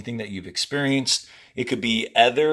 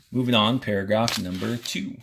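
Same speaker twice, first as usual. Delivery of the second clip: ordinary talk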